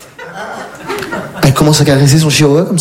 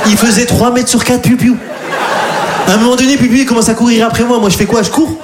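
panicked retelling; 8 semitones higher than usual. Retold or imitated. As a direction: tell it like you're still scared. Panique, poursuivi par un chien. (récit paniqué, plus aigu)

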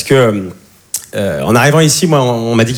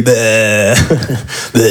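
annoyed ugh; 6 semitones lower than usual. Retold or imitated. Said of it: "Vous parlez comme ça".

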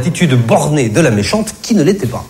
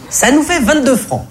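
high cashier voice; annoyed cashier; 12 semitones higher than usual. Acted. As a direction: raise it to the salesgirl's pitch. Caissière répète le prix. (voix aiguë de la caissière)

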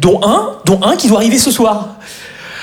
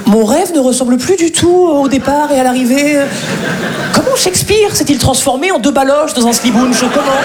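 higher, mock-tragic lament; theatrical dismay; 5 semitones higher than usual. Comedian bemoaning his acting dream, theatrical dismay. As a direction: lament it like a wounded stage actor.

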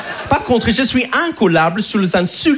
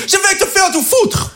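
botched angry insult; 11 semitones higher than usual. Retold or imitated. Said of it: "je dis".

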